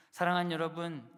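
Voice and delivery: calling out. Real, not acted. Pastor calling out to congregation.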